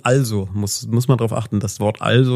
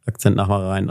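ordinary talk, nothing marked

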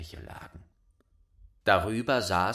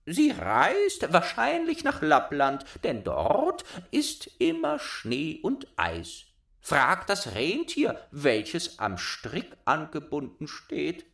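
much higher, louder bird voice; cooing wood doves; 7 semitones higher, 3 dB louder than usual. A narrator's reading. Doves telling Gerda about Kai, high cooing voice. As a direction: coo like a dove.

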